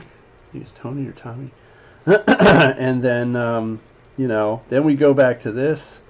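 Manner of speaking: ordinary talk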